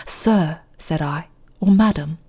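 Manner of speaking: hushed, dramatic mock-British recitation; fake British accent. Streamer continuing The Raven for viewers, fake British accent. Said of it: "You guys really want the fake British accent?".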